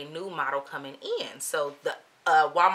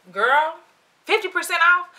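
loud, high disbelieving question; incredulous; 12 semitones higher, 9 dB louder than usual. Retold or imitated. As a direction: repeat it as if you can't believe the deal. Stunned reaction to the discount.

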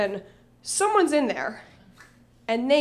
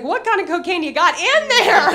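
higher, louder outraged question; acted exasperated shout, pitched up; incredulous yell; 5 semitones higher, 7 dB louder than usual. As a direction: yell through the door in disbelief. Yelling at the door slammer, retold.

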